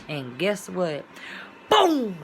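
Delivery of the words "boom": mock explosion boom. Acted explosion in disaster story.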